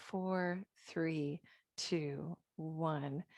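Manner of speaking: soft, slow breathing count; guided breath count. Acted. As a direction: count the breaths gently and slowly.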